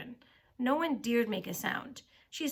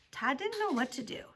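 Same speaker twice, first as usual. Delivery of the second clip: ordinary delivery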